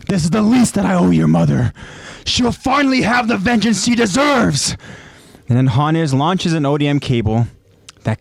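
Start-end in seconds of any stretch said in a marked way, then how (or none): 0.1-4.8 rising to a high, fierce pitch; impassioned vow. Acted. Hannes vowing to avenge Eren's mother, impassioned cry. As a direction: build into a vengeful cry.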